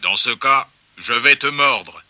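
slow, deliberate threat; menacing. A narrator's reading. Pig threatening the balking Joey, menacing. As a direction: draw the threat out slowly.

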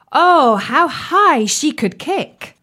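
high, slow, marvelling; amazed. A narrator's reading. Narrator marvels at dance.

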